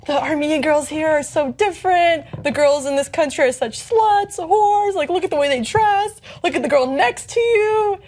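high, judgmental gossip voice; mock disapproval, pitched well up; imitated disdain. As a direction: mimic scandalised classmates, pitch climbing. Mimics classmates disparaging local girls.

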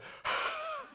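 big exaggerated exhale; mock heavy breath. Preacher pretending to breathe on the recoiling woman, mock exhale. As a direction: breathe out hard at someone, in jest. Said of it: "pull my mou-, mask down and go".

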